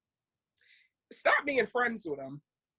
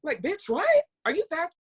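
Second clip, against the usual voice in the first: exasperated; 7 semitones higher than usual. Real, not acted. Ranting at a hypothetical liar, higher and incredulous. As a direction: blast the disbelief.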